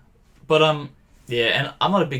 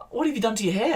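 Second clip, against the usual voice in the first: imitating nan; 9 semitones higher, faster than usual. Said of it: "she goes".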